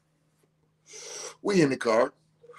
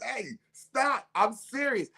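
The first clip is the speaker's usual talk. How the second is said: pleading stop; 9 semitones higher, 4 dB quieter, slower than usual. Retold or imitated. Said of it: "Trying to tell them".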